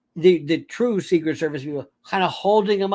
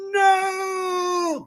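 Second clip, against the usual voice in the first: high, crying mock scream of "No!"; imitating a sobbing protester's "No!"; mock anguished "No!" scream; 11 semitones higher than usual. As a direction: wail "No!" like a distraught fan. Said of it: "the one who said".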